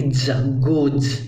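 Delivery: गोज with a dotted ज, said slowly; slow demo. Shown as a demonstration. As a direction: draw out गोज, tongue low for the ज. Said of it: "ये नुक्ता आएगा इसमें".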